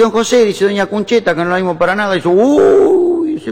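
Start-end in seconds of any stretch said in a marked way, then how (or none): 0.0-0.4 high, loud caller at the door; neighbour woman. Acted. Second neighbour asking after the wife, high-pitched calling. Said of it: "otra vecina". 0.6-2.1 neighbour woman. Acted. Second neighbour asking after the wife, high-pitched calling. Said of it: "otra vecina". 2.3-3.3 very high, loud, held "uuuy"; theatrical wail. Acted. Husband lamenting to second neighbour, hammed-up high wail.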